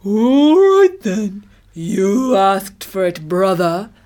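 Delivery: loud, drawn-out rising start, very slow; menacing elephant. A narrator's reading. Elephant about to tread on the tortoise, menacing. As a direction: stretch out the threat as a huge beast.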